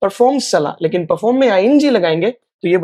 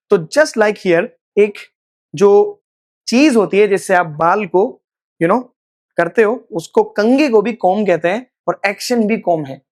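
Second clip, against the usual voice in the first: usual speaking voice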